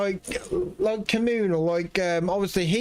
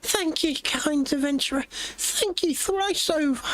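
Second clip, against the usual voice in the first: higher, slow, lavish thanks; grateful NPC voice; 9 semitones higher than usual. Acted. Villager thanking the kind adventurer, high and slow. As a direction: gush your gratitude, stretching the words.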